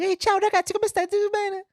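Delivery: loud, very high-pitched Italian greeting; hammed-up squeaky immigrant kid from Palermo; little Italian kid. New kid from Palermo greeting the neighborhood gang, hammed-up little Italian kid. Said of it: "This is little Guinea from Palermo".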